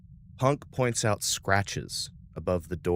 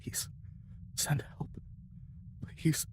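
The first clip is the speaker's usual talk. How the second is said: whispered, fading plea for help; faint plea; 8 semitones higher, 12 dB quieter than usual. Acted. A distress call begging for rescue, faint whisper. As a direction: breathe it like a dying voice over static.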